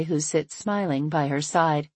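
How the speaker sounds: plain reading voice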